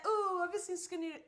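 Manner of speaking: high, quick mock-plaintive question; mock crying. Teasing friend replays his search for her, mock crying. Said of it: "You were crying".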